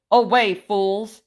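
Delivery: loud, slow furious shout; angry shout. Acted. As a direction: drive the fools away with a roar. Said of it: "he shouted".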